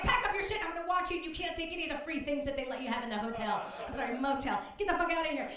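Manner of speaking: high, loud angry orders; furious yelling. Retold or imitated. Producer throwing a litigant out of the motel, yelling. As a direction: bark orders like you've finally had enough. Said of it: "Like, I snapped".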